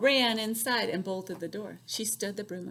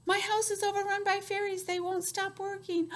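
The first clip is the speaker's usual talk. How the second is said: put-on high fretting old woman; 11 semitones higher than usual. Old woman pleads to wise woman.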